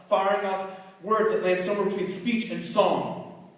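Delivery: intense, rhythmic spoken-word delivery; slam poem recitation. Acted. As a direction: drive each phrase home like a performance poet.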